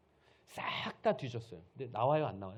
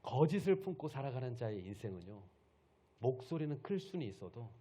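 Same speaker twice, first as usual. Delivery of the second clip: ordinary talk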